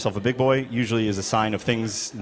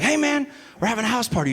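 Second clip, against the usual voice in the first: high, quick dude voice; party dude invitation; 9 semitones higher, faster than usual. Retold or imitated. Dude inviting the comic to a house party, chummy voice. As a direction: pitch it up, fast and casual.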